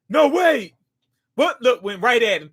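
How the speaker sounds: ordinary talk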